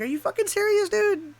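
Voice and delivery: can't believe what he just asked; incredulous outburst. Retold or imitated. Exasperated reaction to cousin.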